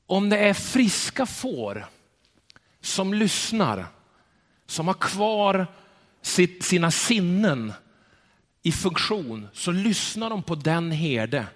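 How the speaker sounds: slow emphasis. Real, not acted. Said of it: "Jag tycker det var".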